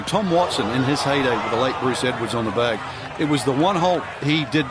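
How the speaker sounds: ordinary talk, nothing marked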